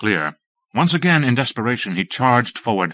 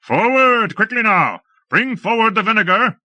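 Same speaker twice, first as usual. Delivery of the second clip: very high, loud urgent shouts; shouting orders; 11 semitones higher, 3 dB louder than usual. A narrator's reading. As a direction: push them on hard. Lord shouting in battle.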